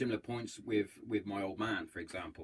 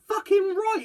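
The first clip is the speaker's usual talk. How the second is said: emphatic shout; 9 dB louder than usual. Real, not acted. Hails Colonel Tom's knighthood.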